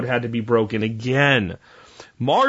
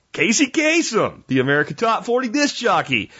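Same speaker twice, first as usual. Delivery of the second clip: radio DJ voice; 9 semitones higher than usual. Pretend. DJ voice he says he mimics.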